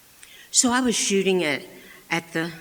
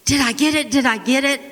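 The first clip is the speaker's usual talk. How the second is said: much higher and louder, breathless eagerness; eager girl; 9 semitones higher, 9 dB louder, faster than usual. Retold or imitated. Girl getting up from the snow, eager to know, excited. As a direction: ask it like a kid who can't wait.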